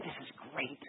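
very soft, low imitated aside; hushed thought. Wife quietly thinking about the jungle project, hushed. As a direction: voice her thought barely aloud. Wife's awed thought, acted.

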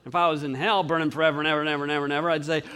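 ordinary delivery

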